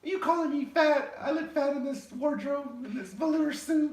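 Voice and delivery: put-on very high, loud falsetto girl. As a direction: squeal it as an offended woman in a put-on falsetto. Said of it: "That was my girl voice".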